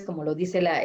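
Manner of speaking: ordinary talk, nothing marked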